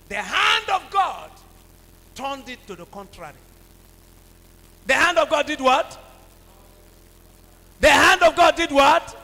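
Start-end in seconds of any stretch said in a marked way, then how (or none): 0.1-1.3 loud, slow, high preaching shout; slow, lifted shout. Real, not acted. Preacher shouting the key phrase. 7.8-9.1 louder call to the congregation; louder repeat to rouse them; louder call to the crowd. Shown as a demonstration. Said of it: "repeated louder to congregation".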